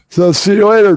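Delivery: loud, high mocking farewell; mocking goodbye. Real, not acted. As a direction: wave the troll off loudly. Dismissing a troll caller.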